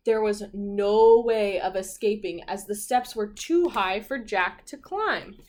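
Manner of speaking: put-on lively read-aloud for children; animated children's story narration; storybook narration. A narrator's reading. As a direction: read it like a picture book to kids.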